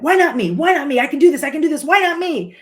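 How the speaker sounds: high, loud fired-up self-talk; driven. Retold or imitated. Athlete pushing herself to win the competition, driven. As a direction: drive yourself on, pitch up and punchy. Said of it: "So that statement of drive".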